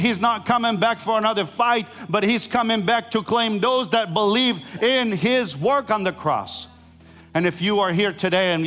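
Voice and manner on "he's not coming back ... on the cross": preaching at full force. Acted. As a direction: preach it out, building to a climax. Preaching climax about return.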